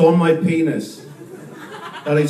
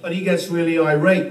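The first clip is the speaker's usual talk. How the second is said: usual speaking voice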